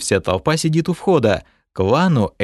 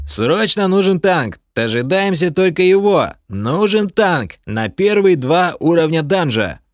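player calling out; 8 semitones higher, 3 dB louder than usual. Acted.

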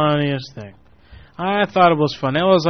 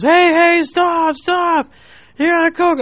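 high, loud call to a passing car; 4 dB louder, slower than usual. Retold or imitated. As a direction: shout after the car to stop it. Stranger calling after the car.

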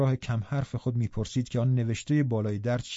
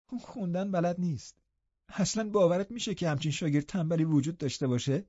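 higher, softer sneering youth; mocking young man; 5 semitones higher, 4 dB quieter than usual. Linton mocks Hareton to Catherine, high and soft. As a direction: scoff at the clumsy cousin.

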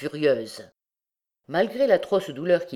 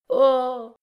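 long, high, emphatic "Oh"; heightened horror; 9 semitones higher, 4 dB louder than usual. A narrator's reading. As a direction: draw the "Oh" out, heavy with horror. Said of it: "mettant dans son interjection plus d'accentuation et plus d'horreur". (cri d'horreur appuyé)

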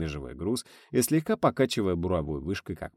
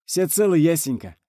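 loud, high reply; shouted reassurance; 9 semitones higher, 6 dB louder than usual. A narrator's reading. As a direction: call back that all is well. Calling back over radio. (громко успокоить по рации)

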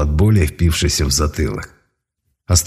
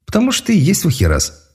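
mocking; 12 semitones higher, faster than usual. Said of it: "с издёвкой ответил внутренний голос".

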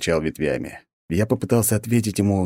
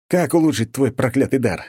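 higher, louder irritated demand; losing patience; starting to boil; 7 semitones higher, 3 dB louder than usual. A narrator's reading. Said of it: "Я начал закипать". (закипая от раздражения)

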